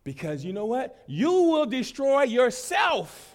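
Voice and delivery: high, loud, slowed-down sermon cry; preaching at full force. Acted. Preacher warning the congregation, full-force high loud preaching. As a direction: preach it to the back row, drawing out each word.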